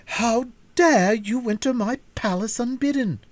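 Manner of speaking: high, loud, imperious outburst; angry princess. A narrator's reading. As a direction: threaten the intruders with chains. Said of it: "cried out".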